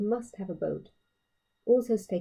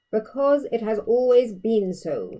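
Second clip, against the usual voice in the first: slow, tired and sighing; weary sigh; 3 semitones higher, 8 dB louder, slower than usual. Acted. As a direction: drag it out like a weary father. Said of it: "the king said when he had finished sighing".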